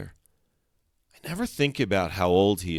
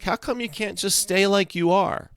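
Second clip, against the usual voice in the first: puzzled; 13 semitones higher than usual. A narrator's reading. Narrator boy asks friend, puzzled.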